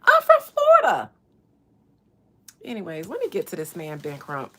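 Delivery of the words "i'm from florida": high, loud squeal; squealed protest. Real, not acted. Streamer protesting her own fake Cali voice, squealing. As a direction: shriek it in disbelief at herself. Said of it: "Why am I talking in a Cali voice?".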